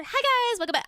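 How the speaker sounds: high-pitched mock influencer greeting. Streamer mocking the influencer accent she denies having, influencer voice. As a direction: put on a peppy influencer hello, pitched right up. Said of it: "I don't think I have the influencer".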